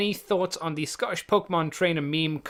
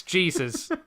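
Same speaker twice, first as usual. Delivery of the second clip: usual speaking voice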